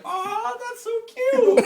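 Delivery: hammed-up girly coo; cooing girl. Quoting the girl.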